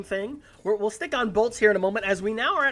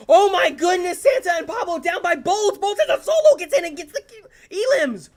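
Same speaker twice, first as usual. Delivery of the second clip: high excited casting; excited shouting; 7 semitones higher, 6 dB louder than usual. Real, not acted. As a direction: shout it up high as the play breaks. Caster hypes a clutch play.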